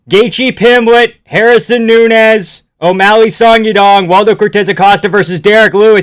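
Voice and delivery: loud, high, real excitement; excited announcing. Real, not acted. Streamer reading the newly revealed card, thrilled and loud. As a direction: belt out each name, stretching it.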